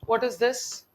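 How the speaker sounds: ordinary talk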